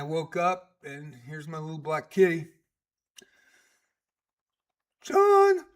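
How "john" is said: high, loud meowed-out name; cat calling John. Retold or imitated. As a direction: yowl the name like a kitty calling its owner. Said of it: "somebody was calling me John".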